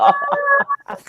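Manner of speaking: a high-pitched startled "Ah"; high squeak. Retold or imitated. Reacting to her plate, high squeak.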